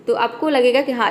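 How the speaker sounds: ordinary talk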